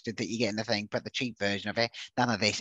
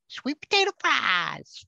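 high, slow, hammed-up deep South American drawl; deep South American accent; 4 dB louder, slower than usual. Shown as a demonstration. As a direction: stretch "Sweet potato fries" out in a mock deep South American accent. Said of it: "a deep South American accent".

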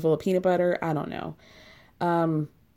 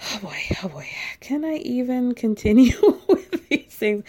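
laughing exasperated; 5 semitones higher, 4 dB louder than usual. Real, not acted. Overwhelmed by the messages.

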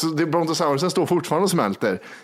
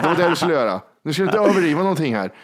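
usual speaking voice

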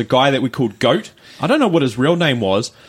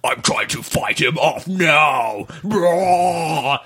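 mock possessed voice; much slower than usual. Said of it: "some sort of weird tongue thing".

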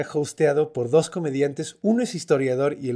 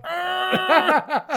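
high bleating goat cry. Retold or imitated. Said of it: "hacer el sonido de la cabra". (balido agudo de cabra)

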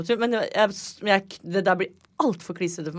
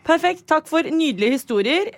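podcast host sign-off; 10 semitones higher, 4 dB louder than usual. Acted. Host wrap-up.

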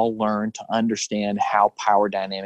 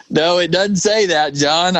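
louder, slower, firm correction; emphatic retold retort; 7 semitones higher, 6 dB louder, slower than usual. Pastor correcting the abuser's scripture, firm and louder. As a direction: set him straight, a bit louder and slower.

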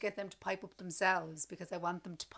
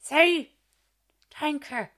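pushy parent; 7 semitones higher, 7 dB louder, slower than usual. Retold or imitated. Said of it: "you can't say".

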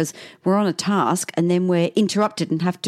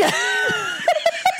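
big laugh. Real, not acted. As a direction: crack up at the joke. Laughing at her own swear.

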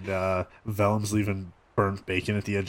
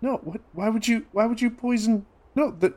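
shocked protest; 12 semitones higher than usual. Acted. Vellum shocked by poison accusation.

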